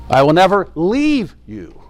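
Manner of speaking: "leave" drawn out and lifted high; stress on LEAVE. Shown as a demonstration. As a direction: stretch LEAVE upward, the peak of the phrase. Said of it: "emphasizing the different words".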